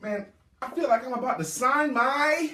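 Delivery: loud, high, hammed-up complaint; mock dramatic exclamation. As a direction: blurt it out big, drawing out the last words. Acts out a dreading client.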